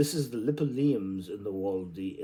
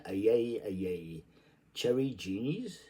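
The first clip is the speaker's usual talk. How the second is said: ordinary delivery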